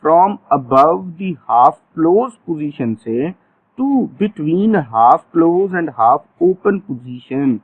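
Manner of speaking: very slow, each word spaced out; stretched-out classroom dictation; slow drill phrase. Shown as a demonstration. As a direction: dictate it slowly for the class to repeat. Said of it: "teacher drills the phrase".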